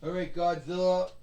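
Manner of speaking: ordinary talk, nothing marked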